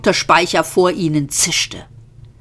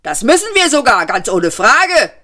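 high, loud called reply; calling back; 6 semitones higher, 4 dB louder than usual. A narrator's reading. Second policeman calling back to his partner, loud. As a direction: call it back across. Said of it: "rief der andere Polizist zurück".

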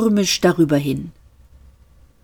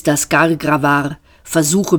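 ordinary delivery

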